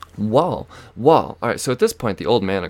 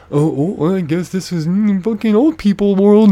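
higher, put-on mocking voice; mocking whine; 11 semitones higher, 7 dB louder than usual. Driver mocks the pushover he won't be, in high mocking voice. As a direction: mimic it in a high, sarcastic singsong. Mocking the old people's attitude.

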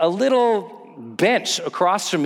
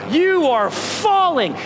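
usual speaking voice

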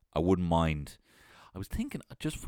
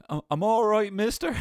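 high, loud confused insisting; dazed pleading lad; 11 semitones higher, 5 dB louder than usual. Retold or imitated. Hallucinating lad telling the teacher he's fine, high and loud. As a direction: insist you're fine while totally out of it. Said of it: "cheese lad".

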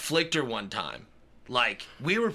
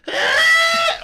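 high fake crying wail; mock wail; 9 dB louder than usual. Mimicking the little girl's fake wail at the door. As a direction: imitate a child's sudden fake sob.

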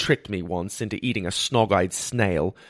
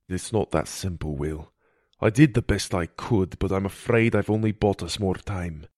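grave healer character; 3 semitones lower than usual. Acted. Healer to poisoned boy, grave and subdued. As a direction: break bad news gently as the healer.

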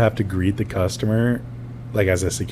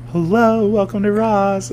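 high, drawn-out flamboyant welcome; loud, camp shop greeting; flamboyant greeter; 12 semitones higher, 3 dB louder, slower than usual. Retold or imitated. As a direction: lilt it up high and stretch it out. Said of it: "this flamboyant".

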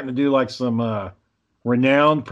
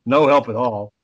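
usual speaking voice

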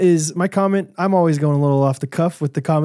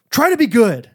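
higher, sing-song "Try to be good"; parent instruction; 9 semitones higher, 5 dB louder than usual. Retold or imitated. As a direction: coax the toddler like a gentle dad. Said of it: "I was like".

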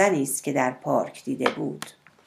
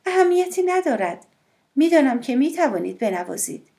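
higher, lively woman's voice; voiced woman, higher and light; woman, playful; 9 semitones higher than usual. A narrator's reading. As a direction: lift her voice high, teasing.

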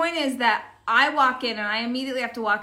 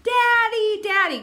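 put-on high, louder toddler calling out; 11 semitones higher, 6 dB louder than usual. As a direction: wail for daddy like a toddler.

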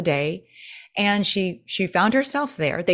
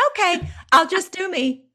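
high, perky, cheerful imitation; a chirpy older woman agreeing, pitched up high; 12 semitones higher than usual. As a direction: play her perky and game. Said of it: "she's very perky".

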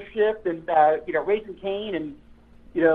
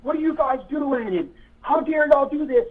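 retold yelling; 8 semitones higher than usual. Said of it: "So he starts yelling".

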